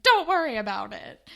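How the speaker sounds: loud, dismissive mock reply; imitated brush-off. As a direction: brush the question aside loudly. Voices Jay brushing off the question.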